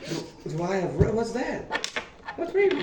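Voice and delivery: stammer, then climb into a high question; baffled. Acted. Guild contact told he has rabies, baffled.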